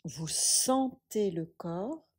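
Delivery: usual speaking voice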